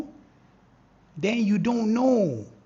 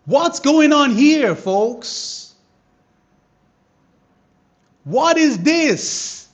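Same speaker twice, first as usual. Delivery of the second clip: higher and louder, slow hyped questions; excited; 6 semitones higher, 10 dB louder, much slower than usual. Real, not acted. Trader amazed as price hits his level, excited. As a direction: marvel loudly at what you see.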